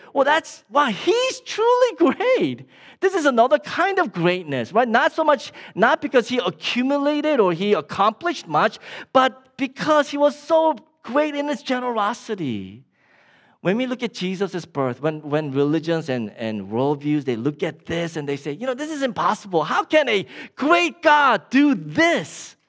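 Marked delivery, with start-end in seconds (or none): 0.1-2.7 high, loud, drawn-out amazement; awed admiration. Acted. Preacher voicing someone seeing the huge donation, awed. 18.5-22.5 raised, emphatic disbelief; incredulous objection. Acted. Preacher voicing other religions at Jesus' birth, incredulous.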